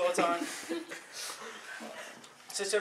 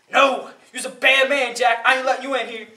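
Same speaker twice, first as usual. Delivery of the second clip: loud, higher-pitched rebuff; angry refusal; 5 semitones higher, 10 dB louder than usual. Acted. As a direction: roundly refuse him, loud and indignant. Devil refuses Jack entry to hell.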